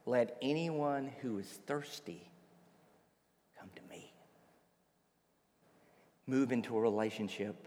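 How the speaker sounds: soft, low, dropping toward a whisper; low hushed appeal, fading away; hushed invitation. Acted. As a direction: draw them in very quietly, with long pauses. Jesus addresses the hushed crowd.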